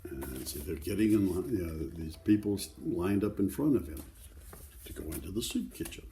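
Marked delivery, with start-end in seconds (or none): none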